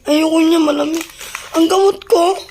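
high, loud exclaims. Real, not acted. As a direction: call out over the noise. (malakas at mataas na boses)

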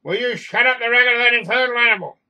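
short-fused outburst. A narrator's reading. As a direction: snap loudly at the noisy cat, out of patience. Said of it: "generally intolerant and has a short fuse".

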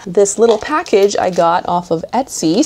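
ordinary delivery

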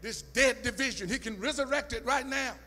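loud, high, rising sermon cry; preaching at full force. Acted. Preacher shouting joy and peace to the congregation, full force. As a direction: preach it hard and let it ring.

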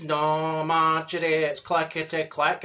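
level, droning correction, a bit low; droopy monotone; droopy Dorothy. Acted. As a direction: drone the correction glumly. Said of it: "And she says".

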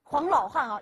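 ordinary talk

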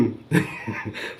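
soft, breath-only laugh; quiet chuckle. Real, not acted. Vlogger about to thank viewers, quiet chuckle. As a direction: chuckle softly under the breath.

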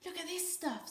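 put-on high, eager boy's voice; excited. A narrator's reading. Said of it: "said Volga excitedly".

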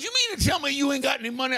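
high, fast disbelieving wife voice; incredulous wife. Retold or imitated. Preacher plays wife stunned he has no money, high and quick.

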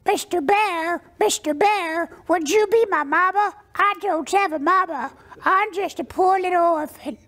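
very high cartoon duckling; perfected duck voice. Boy finally masters the pleading duck, very high cartoon duck voice. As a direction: plead in a squeaky little orphan duck voice. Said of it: "out of my little eleven-year-old mouth came".

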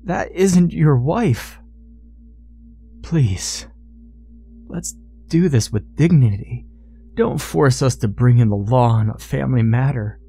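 earnest pleading. A narrator's reading. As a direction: reason with him imploringly, pausing between phrases. Father pleading with son-in-law.

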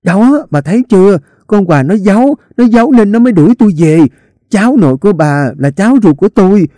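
high, loud, fast shrill yell; yelled inside. A narrator's reading. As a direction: shout it for the whole house to hear. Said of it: "hét vào trong".

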